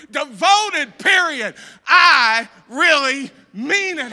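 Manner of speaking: loud, high, slow proclamation; shouted declaration. Acted. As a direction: shout it out to the church. Congregation call, final loud take.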